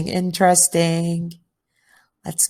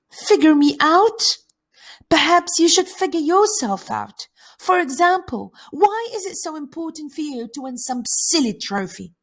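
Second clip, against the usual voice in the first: higher-pitched, offended retort; indignant outburst; 10 semitones higher, 3 dB louder than usual. Acted. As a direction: raise your voice in acted indignation. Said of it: "Forgive me for shouting".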